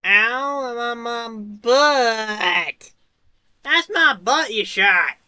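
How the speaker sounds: high, drawn-out whiny yelp; knocked on my butt. Real, not acted. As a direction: whine it high and slow. Knocked down in game.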